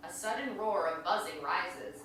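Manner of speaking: ordinary delivery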